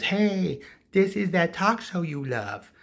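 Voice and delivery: high, loud, stretched-out phone greeting; chirpy caller. Retold or imitated. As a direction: imitate an over-friendly caller. Acts out talk show caller.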